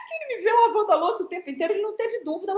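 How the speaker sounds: talking fast, high, through laughter; words spilling out through a laugh. Real, not acted. Mother explaining the joke while still laughing.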